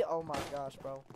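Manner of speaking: ordinary talk, nothing marked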